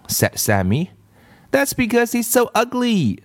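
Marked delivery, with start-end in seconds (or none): 1.5-3.2 put-on high taunting girl voice; Leslie. A narrator's reading. Girl mocks the new boy, high and sneering.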